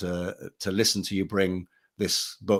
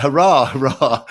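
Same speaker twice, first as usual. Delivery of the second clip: high, loud, slow cheer through a laugh; delighted cheer; 6 semitones higher, 11 dB louder than usual. Retold or imitated. Agent cheering her news with laughing delight. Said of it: "And she was like".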